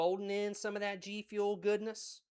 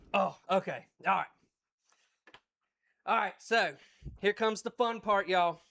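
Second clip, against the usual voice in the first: ordinary talk, nothing marked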